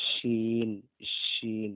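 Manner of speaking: teacher repeats শিন with its hiss; whistled shin. Shown as a demonstration. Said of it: "এটার শিসটা হচ্ছে একটু ব্যতিক্রম".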